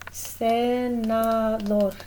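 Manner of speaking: Senador stretched out slowly with Spanish sounds; Spanish pronunciation. Shown as a demonstration. Teacher modeling Senador for the class, slow Spanish. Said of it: "like senator would be".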